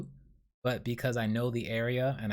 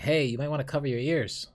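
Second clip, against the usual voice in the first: casual heads-up, a bit higher and louder; casual warning; 5 semitones higher, 5 dB louder than usual. Retold or imitated. Dreamer warning people nearby, casually. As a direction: toss out a friendly warning, lightly raised. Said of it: "Saying this casually".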